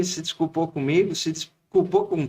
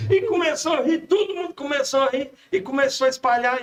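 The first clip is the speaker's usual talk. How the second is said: laughing; 9 semitones higher than usual. Real, not acted. Still laughing while telling.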